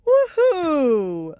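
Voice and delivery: loud, high whoop falling away; big falling woohoo; excited whoop. Real, not acted. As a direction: let out a real whoop at the surprise. Whooping as drills spill out.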